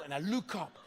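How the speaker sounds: ordinary talk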